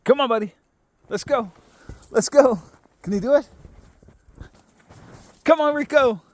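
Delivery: high, loud coaxing call; calling a dog. Real, not acted. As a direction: coax the dog up, high and bright.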